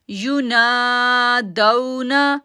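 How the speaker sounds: "युनादौना" slow, "ना" held two seconds; long naa. Shown as a demonstration. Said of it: "ना को हम खींचेंगे". ("ना" को दो सेकंड खींचो)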